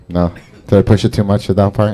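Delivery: ordinary talk, nothing marked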